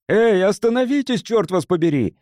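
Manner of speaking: high, urgent shout. A narrator's reading. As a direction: yell to stop them. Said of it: "закричал Кинрос". (громкий крик)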